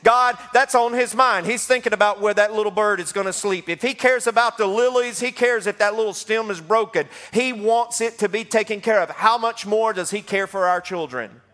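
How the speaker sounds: high, rising preacher's build; fervent preaching. Acted. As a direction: preach it with growing fire to the room. Preaching about God's care.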